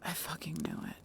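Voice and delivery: unvoiced, soft I-told-you-so; whispered curse. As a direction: whisper it with grim satisfaction. Bex grumbling at the scared human.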